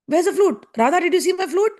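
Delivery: high-pitched acted worry; mock-panicked questioning; alarmed searching. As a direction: ask in rising alarm, hunting for a lost thing. Acting Krishna missing his flute.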